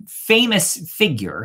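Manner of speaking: ordinary delivery, nothing marked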